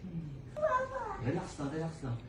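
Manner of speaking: soft, hushed coaching; soothing calm. Real, not acted. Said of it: "sobra ka naman sad".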